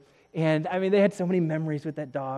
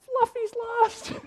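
very high, drawn-out mock wail; hammed-up tearful squeal, barely keeping a straight face; mock sobbing. Wife breaks the news on the phone, mock sobbing. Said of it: "She's, like, sobbing".

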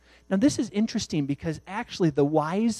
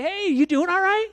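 high, loud call up to a neighbour; hey there, calling up the roof; calling up cheerily; 4 dB louder than usual. Acted. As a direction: shout up to him on the roof.

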